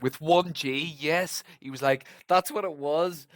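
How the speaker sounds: loud, high burst of remembering; excited recognition. Real, not acted. Host confirms the one G detail, excited. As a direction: jump in, thrilled to recall it.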